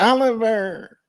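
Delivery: very high mock wail; put-on tearful doubter, drawn out; crying naysayer voice. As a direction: whine the name like a crybaby. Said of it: "I always hear them in my mind like that".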